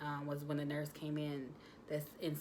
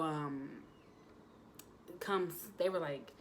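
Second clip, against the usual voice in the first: ordinary delivery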